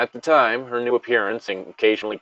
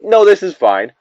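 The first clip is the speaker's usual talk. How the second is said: mock dismissive friend; 9 semitones higher, 9 dB louder than usual. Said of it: "and be like".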